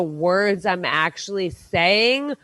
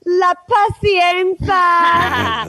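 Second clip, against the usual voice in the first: slow, drawn-out Spanish flourish, higher; mock Spanish; 9 semitones higher, 4 dB louder, much slower than usual. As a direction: savour it like a telenovela line. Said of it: "I don't know if I'm actually speaking Spanish".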